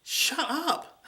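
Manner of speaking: high sneering shut-up; mock inner retort. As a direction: think it with a high snap. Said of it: "still thinking".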